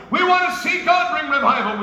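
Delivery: shouted high and loud; fervent preacher's shout; preaching shout. Real, not acted. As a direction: lift the room with a rallying cry. Preacher rallying congregation.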